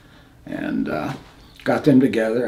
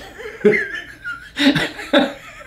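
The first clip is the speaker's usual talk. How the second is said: nervous laugh. Real, not acted. Nervous laugh at near death.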